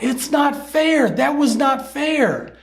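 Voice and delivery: put-on high, drawn-out children's outcry; imitated yelling. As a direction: mimic kids wailing an outraged protest, slowly. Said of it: "they were yelling out".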